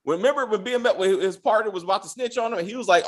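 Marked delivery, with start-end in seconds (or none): none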